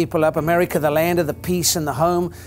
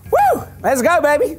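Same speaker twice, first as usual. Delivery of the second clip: much higher, loud eager whoop; excited whoop; 9 semitones higher, 4 dB louder than usual. Retold or imitated. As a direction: leap out of bed whooping. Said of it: "wake up in the morning and go".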